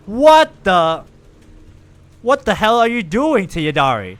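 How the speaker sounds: indignant startled outcry; shocked outburst. Real, not acted. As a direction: react in disbelief at what's happening. Shocked at in-game scene.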